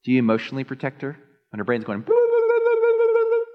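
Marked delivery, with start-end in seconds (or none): 2.0-3.4 high, loud mocking babble; mock chatter. Pastor mocking a wife's racing thoughts, high and loud. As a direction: rattle it off like endless chatter.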